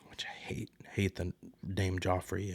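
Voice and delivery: breathy whispered hate; whispered aside. Real, not acted. Recapper confides her dislike of the name, whispered. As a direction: drop to a secret whisper.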